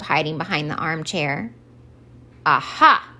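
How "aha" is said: triumphant aha. Acted. Santa realizes.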